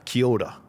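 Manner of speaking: "Kia ora" rolled into "kyo-da"; Māori pronunciation. Shown as a demonstration. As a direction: run "Kia ora" together with a D-like R. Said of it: "So it just rolls".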